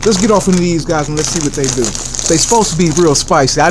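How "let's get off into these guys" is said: ordinary talk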